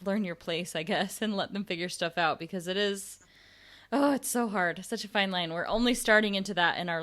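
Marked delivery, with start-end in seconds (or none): none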